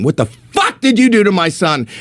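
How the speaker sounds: high-pitched mock scream, held in; retold screaming. Comic playing God to a front-row heckler, retold screaming. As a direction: imitate screaming without full volume. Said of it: "he's, like, screaming".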